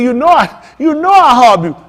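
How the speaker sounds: high, loud, pleading cry of love; pleading protest. Retold or imitated. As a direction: insist like a hurt friend begging to be believed.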